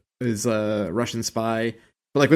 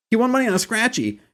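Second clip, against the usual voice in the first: high, quick put-on voice; quoted joke voice; 12 semitones higher, faster than usual. Host quoting a joke from the show, high quick put-on voice. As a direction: toss the line out high and fast in imitation.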